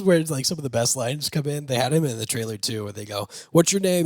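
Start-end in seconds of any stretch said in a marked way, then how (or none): 3.5-4.0 cop asking his name. Retold or imitated. Cops interrogating Bob in trailer.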